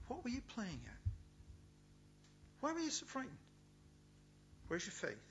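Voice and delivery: half-acted scolding of frightened followers; mild rebuke. Jesus ticking off his disciples, a mild mock scolding. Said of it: "he ticks them off".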